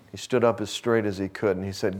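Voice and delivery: ordinary delivery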